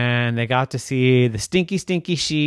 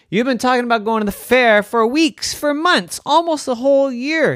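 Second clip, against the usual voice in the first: high, loud disbelief, building; astonished mother; 13 semitones higher, 5 dB louder than usual. Acted. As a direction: exclaim it like an incredulous mom. Astonished Mommy answers Daphne.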